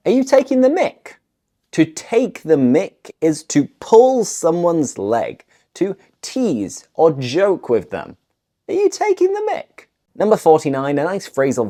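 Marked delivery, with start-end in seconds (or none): 0.1-1.2 high, quick challenging question; mock incredulous. Acted challenge to a teaser. 8.7-9.9 very high, quick disbelieving question; mock incredulous. Acted challenge to a teaser.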